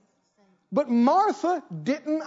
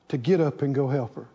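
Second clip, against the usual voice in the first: normal talking voice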